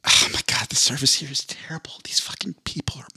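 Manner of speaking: whispered aside, complaining; whispering. Pretend. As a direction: hiss it under your breath. Customer griping under breath.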